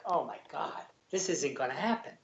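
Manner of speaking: softly voiced gloom, retold; depressed. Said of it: "I'm, like, really depressed".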